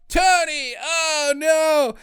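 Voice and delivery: high, drawn-out cry of dismay; mock anguished cry. Outlaw seeing Tony shot, high mock anguished cry. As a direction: wail it in hammed-up horror.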